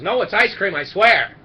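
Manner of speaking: loud, insistent denial; protesting shout. Acted. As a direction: protest it loudly. Penguin protesting at punchline.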